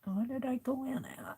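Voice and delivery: whispering. Real, not acted. Crafter murmurs to herself while sewing.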